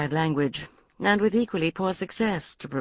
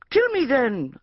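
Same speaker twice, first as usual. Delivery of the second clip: higher, overwrought adventure-novel narration; melodramatic reading aloud; 10 semitones higher, 4 dB louder, slower than usual. Acted. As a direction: read the purple prose with mock heroics. Hero's defiant reply in the novel.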